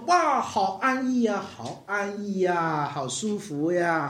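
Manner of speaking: slow, drawn-out 好安逸呀 of the lazy fool; mock fool at ease. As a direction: savour every word smugly. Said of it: "世上的愚顽人说". (模仿愚顽人慵懒地拖长"好安逸呀")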